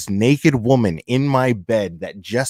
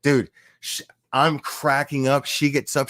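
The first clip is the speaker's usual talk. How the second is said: ordinary talk, nothing marked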